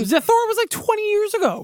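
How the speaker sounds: high, disbelieving squeak; how is that possible; incredulous. Real, not acted. Incredulous reaction.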